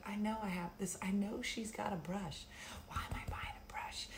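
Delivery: soft talking-to-herself whisper; muttered thoughts. Retold or imitated. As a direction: mutter to yourself in the aisle. Retold inner thoughts in store.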